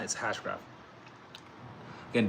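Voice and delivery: usual speaking voice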